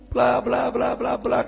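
high, sing-along blah-blah; high-pitched filler babble; babbling skim. Retold or imitated. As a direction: rattle off a mock lover's rant. Skims over the groom's rant.

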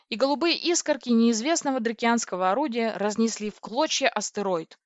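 ordinary delivery, nothing marked

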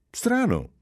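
a high, startled "Strano!"; surprised exclamation. A narrator's reading. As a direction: blurt it out in genuine surprise. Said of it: "esclamò Giulia". (esclamazione stupita)

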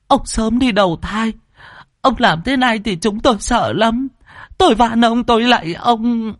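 put-on a high old woman's wailing, stammering prayer; wailing plea. A narrator's reading. Grandmother kneeling and begging her dead husband, high and wailing. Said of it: "vái bù lu bù loa".

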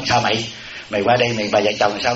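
lightly voiced gossip on the phone, pitched low; gossiping daughter-in-law. Daughter-in-law on the phone with her friend, lightly acted gossip. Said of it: "nó ngồi nó nói chuyện điện thoại với con bạn nó".

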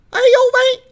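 high, loud pained yelp of surprise; 痛呼惊叫. Acted. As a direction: yelp as the hand jams. 手卡住时惨叫. (高声惊叫哎呦喂)

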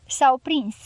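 usual speaking voice

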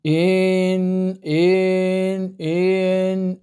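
إن drawn out very slowly each time; stretched vowel error. Shown as a demonstration. Teacher demonstrating the added madd on إن, very slow. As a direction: hold إن far too long. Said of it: "كأنه زاد مدا".

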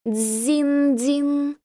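high ringing bell sound; bell chime imitation. A narrator's reading. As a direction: ring it out like a temple bell. Temple bell ringing in scene.

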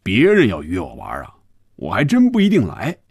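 jovial, animated pitch swings; hearty banter, smile in the voice. A narrator's reading. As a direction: play a chummy, smiling deputy boss. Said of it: "周副总笑着说".

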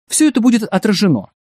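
fast, high-pitched promise; hasty assurance. A narrator's reading. Biographer promising the director it will be included, hasty. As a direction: rattle off the reassurance briskly. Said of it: "быстро сказал биограф".

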